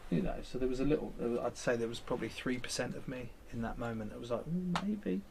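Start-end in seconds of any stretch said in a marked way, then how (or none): 4.5-5.2 high, wavering mock doubt; lightly voiced doubtful self; hesitant inner voice.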